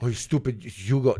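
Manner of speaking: normal talking voice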